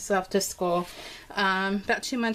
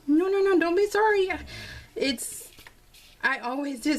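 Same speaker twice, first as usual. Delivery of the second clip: flustered reassurance; 8 semitones higher than usual. Retold or imitated. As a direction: wave off his apology, a little frantic. Reassuring son, emotional morning.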